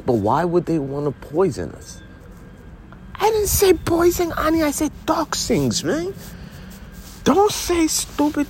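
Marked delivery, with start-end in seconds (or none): 3.1-4.6 high put-on voice. High voice correcting partner. 4.6-5.8 high indignant correction. Acted. 5.8-8.5 high put-on voice. High voice correcting partner.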